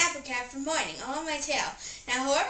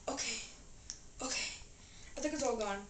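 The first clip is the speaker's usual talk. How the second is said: ordinary talk, nothing marked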